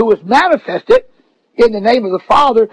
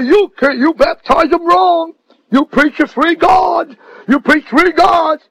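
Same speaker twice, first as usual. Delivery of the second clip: raised, accusing voice, pitch up; mock scolding; 5 semitones higher than usual. Preacher mimicking apostolics accusing Trinitarians, raised scolding. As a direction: scold them like an outraged believer.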